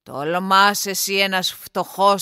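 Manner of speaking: angry nobleman's booming scorn; thundering anger. A narrator's reading. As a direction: thunder down at a lowly suitor. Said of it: "άστραψε και βρόντιξε από το θυμό του".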